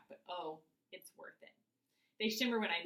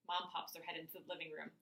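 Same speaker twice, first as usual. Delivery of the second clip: usual speaking voice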